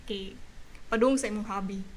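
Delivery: normal talking voice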